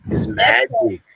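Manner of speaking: high-pitched pretend wonder; mock amazement. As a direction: marvel in play at a magic trick. Plays amazed at people inside TV.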